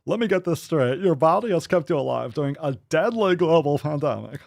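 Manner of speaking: light copy of the smiling guy; imitating the video guy. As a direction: mimic him through a grin. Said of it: "Did I get it".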